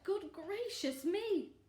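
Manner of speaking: high, drawn-out astonishment; hammed-up storybook surprise; shocked exclamation. A narrator's reading. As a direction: exclaim slowly in mock shock. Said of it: "and exclaimed".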